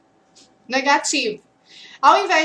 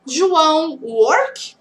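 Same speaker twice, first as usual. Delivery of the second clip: slow model question; 3 dB louder, much slower than usual. Shown as a demonstration. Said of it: "o nome da pessoa".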